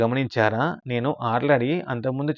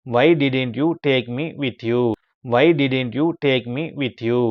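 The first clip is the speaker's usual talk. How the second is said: slow model reading; much slower than usual. Shown as a demonstration. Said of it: "teacher models English sentence slowly".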